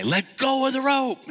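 high loud command; yelling order. Retold or imitated. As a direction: shout over the water. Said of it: "he yells at the guy".

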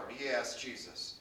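usual speaking voice

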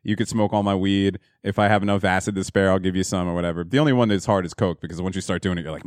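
normal talking voice

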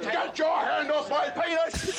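laughing shout. Real, not acted. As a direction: yell it out mid-laugh. Arrested man yelling at police.

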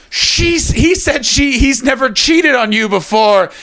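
high, slow squeaky bartender quote; mock bartender voice. To the couple, a mock high bartender voice. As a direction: pitch it up as the gossiping bartender.

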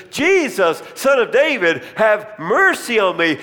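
high, loud desperate plea. Retold or imitated. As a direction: cry out over the crowd trying to silence him.

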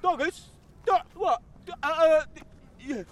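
high, squeaky flustered Tumpy; high character voice. Acted. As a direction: stammer like a startled oddball.

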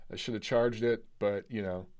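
tired, low hungover voice. Real, not acted. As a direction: sluggish morning-after talk. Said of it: "I'm hungover today".

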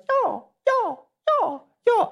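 high childish mocking y'all y'all; squeaky kid voice teasing the teacher's y'all. Kids mocking their teacher's Southern y'all in squeaky childish voices. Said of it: "they would mock me".

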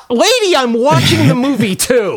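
mock exasperation. Mocking his own reply to the chatty woman. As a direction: snap back in a high mocking voice. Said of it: "She's like, she's like".

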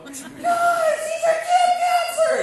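put-on high, loud, drawn-out kid cheering; excited kid shout. Kid shouting to the other campers, high and loud and thrilled. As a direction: yell it like a thrilled kid announcing the news. Kids shouting to cabin mates.

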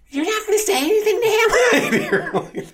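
very high, loud, slow scandalised whine; mock gasp. Bank woman gasping at the manager, very high and slow. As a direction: gasp then plead in outrage.

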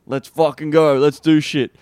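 loud, hyped-up rallying call; gung-ho. Acted. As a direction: fire them up, loudly. Acting out his usual gung-ho approach.

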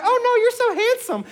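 put-on very high, sweet girl's voice; imitating daughter. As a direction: chirp like his adoring daughter. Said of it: "And she was like".

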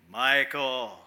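lightly voiced booming call from above; mysterious godly voice. God calling a boy by name, lightly voiced mysterious call. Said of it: "mysterious, godly voice".

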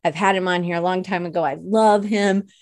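normal talking voice

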